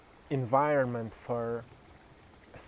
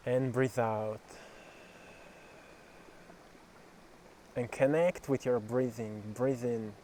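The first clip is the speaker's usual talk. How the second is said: guided meditation; 3 dB quieter than usual. Acted. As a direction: guide them gently through each breath.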